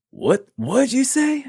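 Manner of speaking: very high, slow baffled question; confused disbelief. Retold or imitated. Stunned attacker asking him to repeat, high baffled. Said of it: "the confusion on his face".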